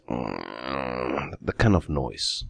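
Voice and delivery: slow, low groan over the words; drawn-out low groan. Shown as a demonstration. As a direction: groan it out as if in pain. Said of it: "It's kind of like this".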